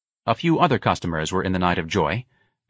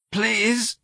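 put-on a high, begging old man's plea; pleading old man. A narrator's reading. Old man begs the sergeant not to call, pleading. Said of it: "Mister Robichaud pleaded".

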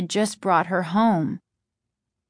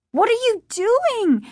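high, alarmed pleading question; panicked girl pleading with her dad; scared girl asks a menacing father; frightened question. Acted. Daughter faces father with axe.

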